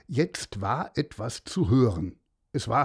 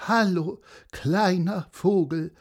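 higher, mildly pitiful horse; a touch of feebleness, pitched up; weak voice; 6 semitones higher, 3 dB louder than usual. A narrator's reading. Crying horse greeting the little bird, mildly weak. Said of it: "sagte das Pferd mit schwacher Stimme".

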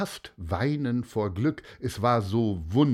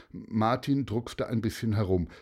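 usual speaking voice

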